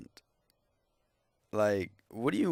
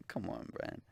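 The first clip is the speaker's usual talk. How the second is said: muttered aside; 11 dB quieter than usual. Real, not acted.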